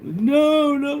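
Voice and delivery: high, drawn-out mock protest; mock panicked refusal. Streamer acting out a viewer fending off a girl, mock protest.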